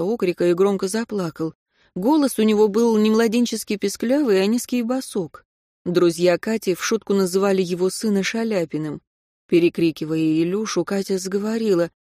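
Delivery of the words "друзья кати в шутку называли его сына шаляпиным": ordinary delivery, nothing marked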